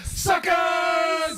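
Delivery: loud high yell; shout. Real, not acted. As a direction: yell it out at full voice. Shouting at the screen.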